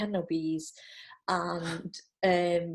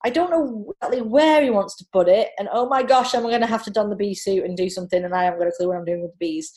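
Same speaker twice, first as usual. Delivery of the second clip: panicked thoughts; 8 dB louder than usual. Retold or imitated.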